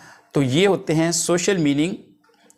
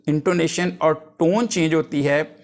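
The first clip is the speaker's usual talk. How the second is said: ordinary talk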